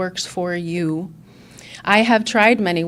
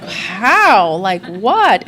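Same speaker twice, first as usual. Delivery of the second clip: baffled protest; 7 dB louder, much slower than usual. Retold or imitated. Baffled by sponsor's feedback.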